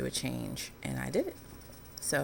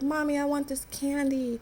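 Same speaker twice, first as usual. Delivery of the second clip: put-on kids begging; 9 semitones higher than usual. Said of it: "They're like".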